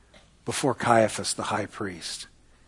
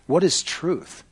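raised-pitch rhetorical challenge; dismissive retort; 3 semitones higher, slower than usual. Acted. Pilate answering Jesus, dismissive rhetorical question. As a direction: toss the question back as a challenge.

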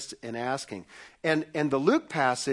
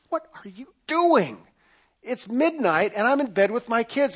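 annoyed neighbor; 6 semitones higher, 3 dB louder than usual. Retold or imitated. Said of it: "the neighbor comes to the door and says".

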